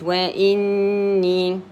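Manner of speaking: slow وإني, held n then two-beat final ee; two-count ya. Shown as a demonstration. Teacher gives the ya two periods, slowly prolonging وإني. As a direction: stretch both the n and the ee of وإني. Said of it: "I give it two periods".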